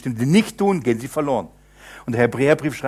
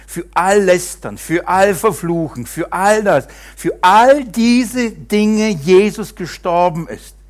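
louder and higher, slowing on the last line; building sermon climax; 6 semitones higher, 7 dB louder, slower than usual. Acted. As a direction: build to a passionate peak. Preaching climax. (sich steigernde Predigt)

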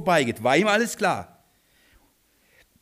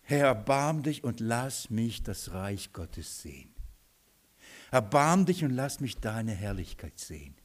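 soft, humble plea to the Lord; preacher voicing a believer's soft prayer for mercy; quiet prayer; 6 semitones lower, 7 dB quieter than usual. Shown as a demonstration. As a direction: pray it gently, nearly to yourself. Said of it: "Darf ich so sagen?".

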